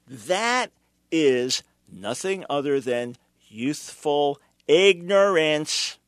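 youthful ignorance drawn out slowly, ignorance hit loud; slow emphasis. Acted. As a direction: lean hard on youthful ignorance. Host punches his key phrase.

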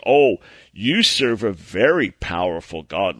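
low, slow sneering taunt, darker timbre; mocking enemy voice. Preacher voicing the enemy mocking him in his ear, low slow sneer. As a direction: drip with contempt like a tempter. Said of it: "the enemy's in my ear saying".

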